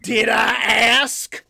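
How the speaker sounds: loud, high, stretched-out retort; mock indignant shout. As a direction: shout it back in preacher style. Mock preacher snaps back at co-host.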